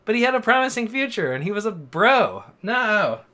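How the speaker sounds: worked-up fan dismay; dismayed protest. Real, not acted. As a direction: lament a favorite character loudly, ending on a cry. Protesting a favorite character's death.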